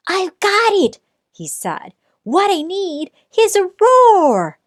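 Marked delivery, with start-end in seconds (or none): 0.1-1.0 much higher, a squeaky eureka; tiny mouse struck by a bright idea; excited mouse voice. Acted. 2.2-4.6 much higher, a squeaky eureka; tiny mouse struck by a bright idea; excited mouse voice. Acted.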